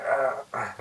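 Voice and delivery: straining grunts. Retold or imitated. Hero trying to raise the vendor, imitated straining grunts. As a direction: imitate heaving at a heavy load.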